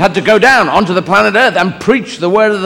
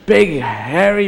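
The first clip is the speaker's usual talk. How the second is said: higher-pitched, pestering son; Jesus questioning Dad; 4 semitones higher than usual. Acted. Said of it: "Jesus must have asked a few questions like".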